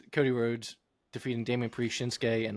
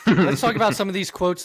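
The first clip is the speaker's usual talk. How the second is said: laughing, higher and louder; 7 semitones higher, 8 dB louder than usual. Real, not acted. Host laughing while trying to move on. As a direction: push through the laughter.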